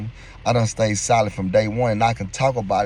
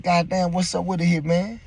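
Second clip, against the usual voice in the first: surprised greeting; 7 semitones higher than usual. Retold or imitated.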